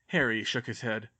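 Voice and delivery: ordinary delivery, nothing marked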